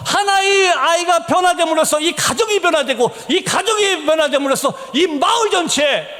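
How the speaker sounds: high-pitched, urgent preacher's appeal; fervent sermon plea; impassioned pleading. Real, not acted.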